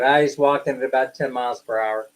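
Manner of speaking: normal talking voice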